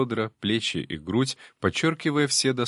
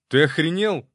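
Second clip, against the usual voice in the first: sharp shout, pitched high and loud; yelling; 8 semitones higher, 4 dB louder than usual. Acted. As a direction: bark it out in outrage. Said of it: "заорал я на Ксантипа". (кричит в возмущении)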